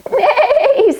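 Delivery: whinny it like a horse; horse's high neigh. Acted. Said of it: "said Horse".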